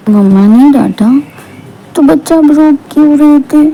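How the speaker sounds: put-on high-pitched baby-talk question; cooing to a child. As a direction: echo a toddler in sympathetic parent talk. Acted father coos to crying daughter.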